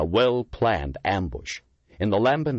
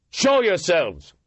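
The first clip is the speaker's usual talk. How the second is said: loud, high, slow shout into the dark; shouting challenge; 12 semitones higher, 5 dB louder, slower than usual. A narrator's reading. Lieutenant shouting a challenge into the dark tunnel. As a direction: call out the unseen attackers. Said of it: "Miller shouted".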